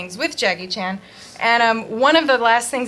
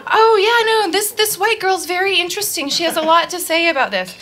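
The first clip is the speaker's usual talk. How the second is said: airline neighbour voiced; 7 semitones higher, 3 dB louder than usual. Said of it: "he's like".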